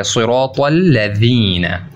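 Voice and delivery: सिरातल लदीना very slowly, tongue touching the teeth for the dal; soft dental dal, slow; slow, heavy सिरात then soft dal. Shown as a demonstration. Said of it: "दाल वो soft पढ़ा जाएगा".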